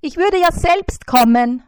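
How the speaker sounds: high, loud fly's call. A narrator's reading. Fly Bissi calls the spider, high and loud. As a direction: shout up to the spider, shrill and small. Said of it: "sagte Bissi".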